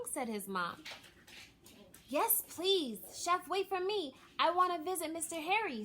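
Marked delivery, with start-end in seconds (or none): 2.1-5.8 put-on higher, louder, quick boyish eagerness; rushing to tag along; eager hurry. A narrator's reading. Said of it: "said L J as he hurried up".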